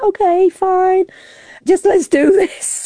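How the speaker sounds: high, slow, drawn-out agreement; breezy go-ahead. Retold or imitated. Swimmer accepting the changed course, breezy go-ahead. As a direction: agree airily, stretching it out high. Acts resigned go-ahead to crew.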